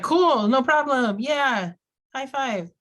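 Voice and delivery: high, breezy fake approval; mock enthusiasm. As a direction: cheer as if pretending all is great. Acts out an unbothered customer.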